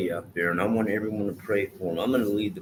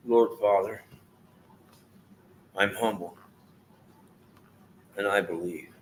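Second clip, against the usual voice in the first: heartfelt prayer. Acted. Praying aloud for his friend Aaron, earnest prayer. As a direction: pray sincerely, letting each phrase land.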